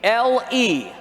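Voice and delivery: spelled out very slowly, letter by letter; teasing slow dictation; slow spelling. Shown as a demonstration. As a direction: drag out each letter for note-takers. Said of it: "Write this down".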